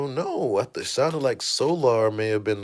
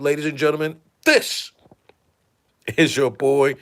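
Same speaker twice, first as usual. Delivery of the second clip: usual speaking voice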